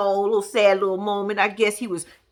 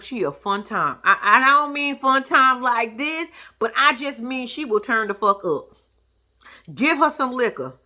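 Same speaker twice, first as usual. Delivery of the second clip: ordinary talk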